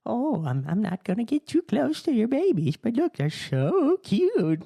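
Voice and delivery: hammed-up old lady cooing; nosy old lady voice. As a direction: coo over the babies like a prying old woman. Mimicking old women with babies.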